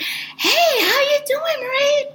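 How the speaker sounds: mock-friendly, very high-pitched greeting; best-friend chummy. Representative calling like a best friend, mock chummy. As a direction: greet like nothing ever happened. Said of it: "as if we were, like, best friends".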